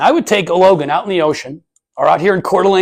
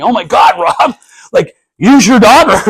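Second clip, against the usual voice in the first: much higher, louder, excited squeal; excited callers; 8 semitones higher, 10 dB louder, slower than usual. Retold or imitated. Networkers excitedly urging dad to use his daughter, high and loud. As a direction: gush like a fan who just saw the video.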